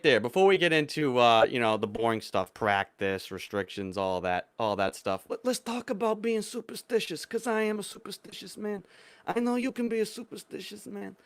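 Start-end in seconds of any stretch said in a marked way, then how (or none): 5.3-11.1 raspy Godfather mob boss impression; Don Corleone. Repeats Don Corleone superstitious line.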